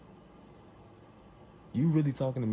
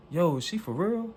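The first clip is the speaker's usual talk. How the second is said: much higher and louder, incredulous question; 10 semitones higher, 5 dB louder than usual. Retold or imitated. Customer asking the whole shop about the clerk, out loud. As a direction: call it out so everyone nearby hears. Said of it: "I'm saying this out loud".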